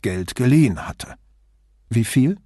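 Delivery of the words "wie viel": usual speaking voice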